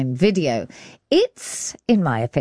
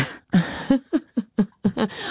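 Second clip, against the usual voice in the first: higher-pitched amused laugh; amused laughter; 5 semitones higher than usual. Real, not acted. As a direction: laugh along at a bad accent example. Trading worst accent examples.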